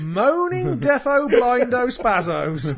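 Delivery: high, loud, slow mocking jeer; over-the-top bigot parody. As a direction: sneer it out as a crude bigot. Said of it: "I was playing devil's advocate".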